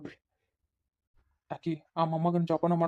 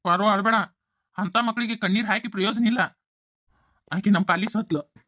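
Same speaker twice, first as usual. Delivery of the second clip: raised, higher voice, cutting her off; stern loud rebuke; 4 semitones higher, 5 dB louder than usual. Acted. Father silencing his weeping wife, loud stern order. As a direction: order her to stop crying, loud and hard.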